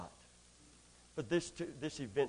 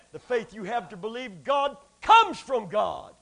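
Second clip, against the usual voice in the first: usual speaking voice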